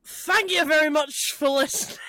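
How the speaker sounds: put-on slipping back into a Scottish accent. Retrying Tom's sign-off for co-hosts, unintentionally Scottish accent. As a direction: attempt an impression that lands in Scottish. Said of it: "Back to Scottish again".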